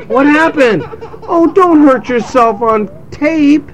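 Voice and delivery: high, drawn-out real exclamation; startled parent. Real, not acted. Parent to child hurt during taping, startled.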